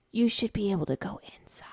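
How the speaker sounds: soft breathy whisper. Real, not acted. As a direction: drop to a whisper. Unvoiced whispered line.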